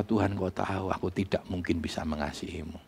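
low, very soft voice imitating a broken Peter; mild retold tearful confession. Peter confesses to Jesus after betraying him, quietly sorrowful. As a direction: sink into a subdued, defeated admission. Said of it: "Dia menangis, dia berkata".

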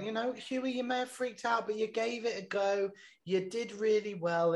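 a hint of a gentle older carer; Nanny voice. Nanny's pep talk voiced.

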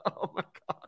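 laughing hard. Real, not acted. Cracking up at the story.

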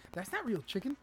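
high, insistent warning; mock alarm. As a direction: imitate an alarmed friend. Voices the alarmed friend.

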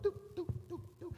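high, steady checkout beep; high-pitched electronic blips by mouth; imitated scanner beeps. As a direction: mimic a register scanner, "doop, doop". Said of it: "those new beeper checkouts".

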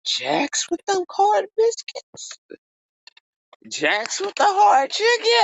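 very high, drawn-out disbelief; incredulous squeal. Retold or imitated. Reacting to hard chicken and biscuits, incredulous squeal. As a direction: squeal the question in retold shock. Retells shock at hard food.

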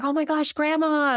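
high, delighted greeting; excited greeting. Retold or imitated. Granddaughter greets her grandma in a dream, excited.

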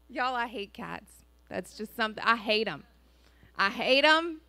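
usual speaking voice

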